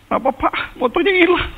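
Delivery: a retold tearful wail; crying. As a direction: sob it out in a high cry. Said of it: "saya mulai nangis".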